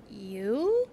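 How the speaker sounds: small rising question; astonished. A narrator's reading. Poppy astonished by the porcupine's boast, questioning. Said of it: "Poppy cried with astonishment".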